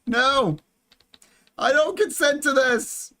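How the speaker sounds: high-pitched cry of refusal; alarmed protest. Real, not acted. Protesting a sudden ant attack.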